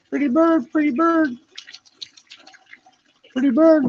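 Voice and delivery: high coaxing bird talk; talking to a parrot. Real, not acted. Coaxing the shy parrot to talk, high sing-song.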